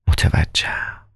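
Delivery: whisper. Acted. Doctor speaks low in clinic.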